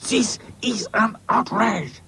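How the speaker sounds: high, slow indignant French character; spluttering outrage. Acted. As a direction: protest word by word, highly affronted. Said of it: "spluttered Claude".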